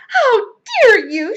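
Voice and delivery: slow, high, loud outrage; angry shout. A narrator's reading. Soaked guest to her hosts, angry shout. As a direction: shout it in indignation, each word weighted. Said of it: "she shouted".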